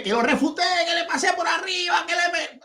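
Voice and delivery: high-pitched mimicked yelling; mock shouting. Debater mimicking his opponent's shouted boasts, mock shouting. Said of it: "él solo está gritando, ... sino gritaron solamente".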